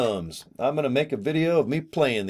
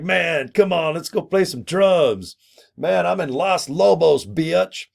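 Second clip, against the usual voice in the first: higher, cocky little dude talking tough; cool doll voice; 6 semitones higher, slower than usual. Pretend. The drummer doll invites the speaker to jam, in a cocky higher voice. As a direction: give the doll a swaggering rocker attitude. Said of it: "he's like".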